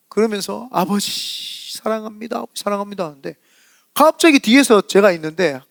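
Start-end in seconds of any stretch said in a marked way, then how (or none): 0.7-3.0 soft, weeping call to the Father; a gently retold sobbing prayer; tearful prayer, softened. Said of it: "막 펑펑 우시는 거예요".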